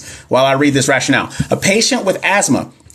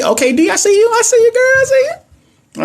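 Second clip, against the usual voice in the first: excited cheer. Real, not acted. Cheering on a student's answer.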